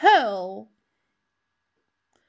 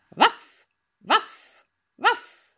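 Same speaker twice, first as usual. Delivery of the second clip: dog bark; 10 semitones higher, 5 dB louder than usual. Retold or imitated. Imitating a dog.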